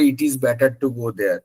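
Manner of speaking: slow Bangladeshi English with full syllables; Bangladeshi accent. Retold or imitated. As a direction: say it the way Bangladeshis do, slowly. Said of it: "আবার বাংলাদেশে যখন আসে তখন বলে".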